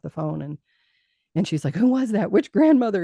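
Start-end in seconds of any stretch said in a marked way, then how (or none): 1.8-3.0 loud, high-pitched alarmed demand; retold freaked-out yell. Mother questioning her child about the phone call, freaked-out yelling. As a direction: act out a panicked parent yelling. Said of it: "she's all freaked out yelling at me".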